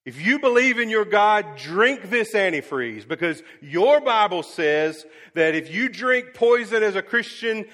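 loud, high, driving sermon delivery; fiery preaching. Acted. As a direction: preach it hard to the whole church. Preaching at full force while retelling.

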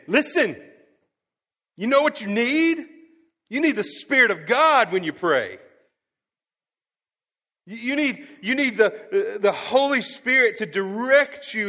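high, loud insistent exclamation; emphatic call. Retold or imitated. Jesus pressing the disciples about the Spirit, loud and emphatic. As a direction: drive every word home like a promise. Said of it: "I'm not saying He's yelling it".

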